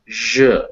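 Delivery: "zh" drawn out as in garage; zh sound. Shown as a demonstration. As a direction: sound out a voiced "zh" alone, as in azure. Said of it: "like in delusion or garage".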